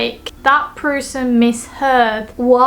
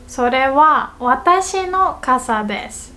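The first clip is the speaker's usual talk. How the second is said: slow phrase with 私の raised; stress on 私の; 2 semitones higher than usual. Shown as a demonstration. Teacher repeating wa example, stress on 私の. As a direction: pause after それは, then lift 私の. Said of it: "what comes after the wa particle is".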